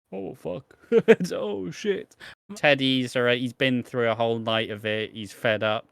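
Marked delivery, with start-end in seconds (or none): 0.1-2.2 mock shock. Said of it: "Barney's here like".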